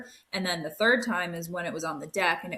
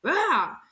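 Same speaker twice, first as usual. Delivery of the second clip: imitated yell; 8 semitones higher, 5 dB louder than usual. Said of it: "it was like".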